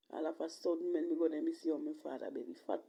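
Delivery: ordinary talk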